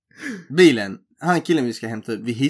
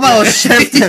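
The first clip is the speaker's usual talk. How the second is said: much higher and louder snap; mock shout; 10 semitones higher, 11 dB louder than usual. Telling his cocky friend to shut up, shouting. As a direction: shut him up, loud.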